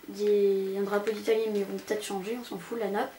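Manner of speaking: normal talking voice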